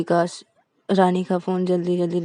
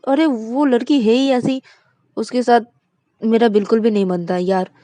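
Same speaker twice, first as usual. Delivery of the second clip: louder, exasperated complaint; loud protest; 4 semitones higher, 9 dB louder than usual. Acted. Vikas to Shruti, complaining about Rani, loud and exasperated. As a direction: push back hard, voice raised.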